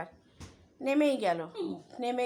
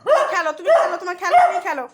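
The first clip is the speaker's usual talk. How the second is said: high pet scolding; 4 semitones higher, 8 dB louder than usual. Real, not acted. Telling the pet cat to stop yowling, high loud baby talk. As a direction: coax the cat back to play, high and loud.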